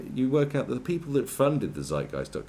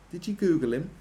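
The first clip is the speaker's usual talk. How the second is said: normal talking voice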